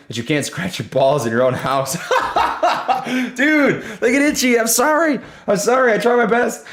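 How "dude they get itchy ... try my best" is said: talking through high laughter; words squeezed out while still laughing; giggling apology through the laugh. Real, not acted. Laughing at a viewer's joke.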